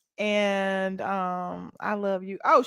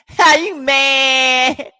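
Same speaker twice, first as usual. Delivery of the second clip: mocking laugh; 5 semitones higher, 14 dB louder, much slower than usual. Real, not acted. Mocking a hater in chat.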